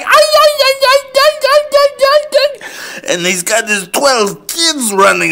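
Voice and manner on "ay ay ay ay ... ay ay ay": very high yapping dog cries; dogs yelping. Pretend. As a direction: yelp like little dogs in the truck pickup. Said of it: "they're like".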